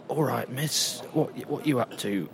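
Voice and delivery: a Cockney guard gently asking what you're up to; Cockney accent. Retold or imitated. Cockney guard questioning an intruder in the Tower, Cockney accent. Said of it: "in a kinda Cockney accent".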